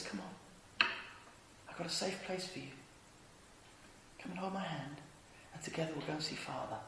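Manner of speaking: hushed, low, comforting invitation; tender reassurance. Acted. Jesus offering hurt people a safe place, tender and hushed. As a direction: reassure very softly and gently.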